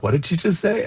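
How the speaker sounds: high, baffled mock question; surprised bystander. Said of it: "And people are like".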